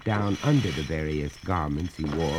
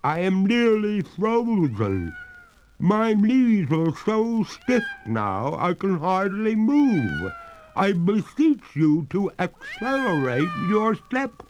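high, slow, loud freezing plea; distressed ghost; 13 semitones higher, 5 dB louder, slower than usual. A narrator's reading. Freezing ghost begging the master to hurry. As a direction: beg like you're freezing stiff. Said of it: "signs of distress".

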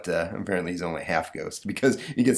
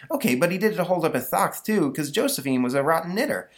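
put-on boy voice; 9 semitones higher than usual. Stanley insists in class.

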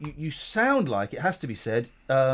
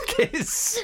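high laughing squeak; 4 dB quieter than usual. Real, not acted. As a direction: giggle the word out. Laughs while repeating.

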